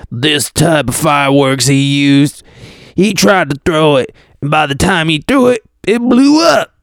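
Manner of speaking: neighbor quote voice. Acted. Quoting another neighbor from the news, put-on character voice. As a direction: play the neighbor, build up to the last words. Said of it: "I love doing those voices for the quotes".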